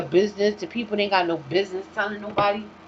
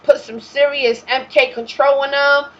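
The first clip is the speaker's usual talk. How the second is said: louder, higher scheming planner voice, hammed up; mock colonizer planning; 5 semitones higher, 10 dB louder than usual. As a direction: boom out their plan like a smug overlord. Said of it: "They had a plan, like".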